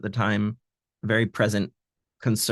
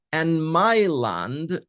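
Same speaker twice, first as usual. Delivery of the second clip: my Land drawn out very slowly and higher; slow, stressed my Land; 5 semitones higher, much slower than usual. Shown as a demonstration. Translator explaining the pun, slowly stressing my Land. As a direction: spell out my Land word by word. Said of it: "some sort of joke here".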